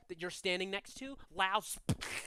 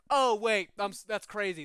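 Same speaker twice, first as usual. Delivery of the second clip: loud start dropping back to chat; sudden relief; 2 semitones higher, 9 dB louder than usual. Acted. Dead man reassuring himself, mild sudden relief. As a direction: shrug off the panic, lightly acted. Stuck soul calms himself after panic.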